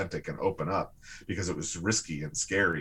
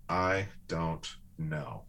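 "I don't know" said slowly and softly, drawn out and lower; slow, soft admission; 3 semitones lower, 6 dB quieter, much slower than usual. Shown as a demonstration. Showing the words he learned to say, slow soft I don't know. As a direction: let "I don't know" sink in gently. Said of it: "learning to say these words".

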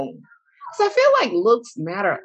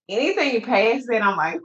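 usual speaking voice

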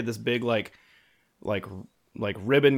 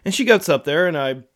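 usual speaking voice

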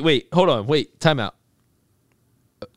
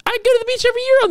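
high fast boast; much faster than usual. Real, not acted. Boasting about beach trips.

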